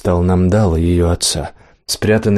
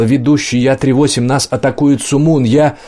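panicked radio yell; 5 semitones higher than usual. Acted. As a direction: scream it into the radio, terrified. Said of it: "панически заорало".

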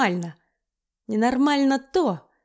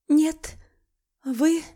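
sniffling; 6 semitones higher than usual. Acted. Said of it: "всхлипнула я".

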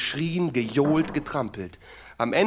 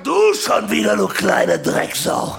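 menacing growl; 5 semitones higher, 8 dB louder, slower than usual. Retold or imitated. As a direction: snarl at the child. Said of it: "und sag". (tief knurrend)

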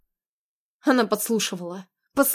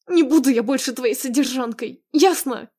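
higher, heated protest; raised, defiant outburst; angry refusal; 4 semitones higher, 4 dB louder than usual. Acted. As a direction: throw down an ultimatum, rising to the end. Wife angrily refuses husband.

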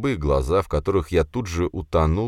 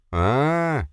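high, drawn-out mocking "ah"; snide drawl; 9 semitones higher than usual. A narrator's reading. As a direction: stretch it out with malicious relish. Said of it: "ехидно протянул я".

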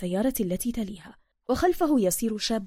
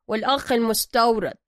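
gruff master mechanic; 6 dB louder than usual. Acted. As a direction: greet him like you own the place. Zergina asks Bruce.

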